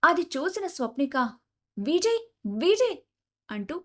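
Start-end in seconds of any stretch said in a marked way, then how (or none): 1.8-3.0 higher, slow alarmed call; panicked call. A narrator's reading. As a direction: call out the name in mild panic, without shouting. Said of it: "గాబరాగా గట్టిగా అరుస్తుంది". (గాబరాగా పిలవడం)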